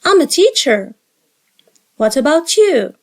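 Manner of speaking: ordinary talk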